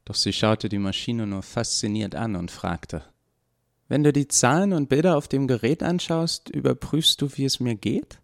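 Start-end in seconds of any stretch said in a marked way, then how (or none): 3.9-8.2 put-on higher young voice, wide-eyed question; fascinated Eva. A narrator's reading. Eva asks the doctor about the monitor, fascinated higher voice. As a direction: ask as Eva, fascinated by the machine.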